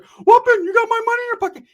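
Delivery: very high, loud cartoonish squeak; squeaky [name] voice. [name] squealing back at his teasing cousin. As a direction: squeal it out like Mickey Mouse.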